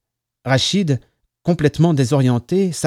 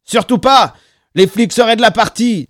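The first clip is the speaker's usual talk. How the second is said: high, loud protest; urgent, raised warning; alarmed objection; 8 semitones higher, 8 dB louder than usual. A narrator's reading. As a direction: cut in loudly to forbid it. Alarmed objection to calling ambulance.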